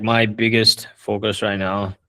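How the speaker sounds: normal talking voice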